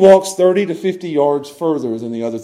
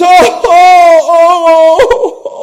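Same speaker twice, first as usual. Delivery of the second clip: loud, high repeated sobbing moans; acted wail breaking into sobs; 11 semitones higher, 8 dB louder, much slower than usual.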